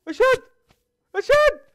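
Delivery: very high, loud panicked cry. Real, not acted. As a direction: shriek it as if facing death. Gamer panicking in a fight.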